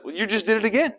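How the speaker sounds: high-pitched gotcha; gleeful accusation. Acted. Accusers catching Peter's accent, high and accusing. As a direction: catch him out in a high mock voice.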